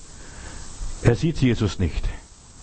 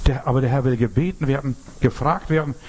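normal talking voice

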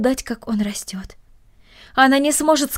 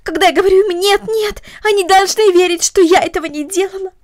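upset pleading; 8 semitones higher, 4 dB louder than usual. Acted. Girl pours out to Marta.

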